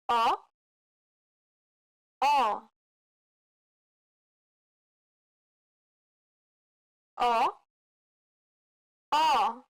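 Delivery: "O" said as an open O, like the o in hot. Shown as a demonstration. Teacher modelling the Portuguese open O for learners, clear and open. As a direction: open the mouth wide for "O". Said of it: "the open O sound in Portuguese".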